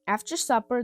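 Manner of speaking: ordinary delivery, nothing marked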